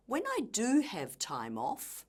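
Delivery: "do" punched high in "When I do have time off"; stress on DO. Shown as a demonstration. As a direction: lean hard on the key word "do". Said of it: "used stress to emphasize keywords".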